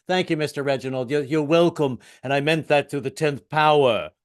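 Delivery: put-on sophisticated British accent. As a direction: trade politenesses in a British accent. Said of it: "of course, is the British accent".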